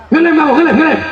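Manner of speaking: loud, sharp police scolding; angry scolding. Retold or imitated. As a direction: bark it like a stern cop. Police chief scolding a glue sniffer. (marah membentak)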